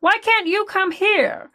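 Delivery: mocking impression of a reluctant mom. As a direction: do your mother, put out and high. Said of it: "so I'm just gonna do her impression".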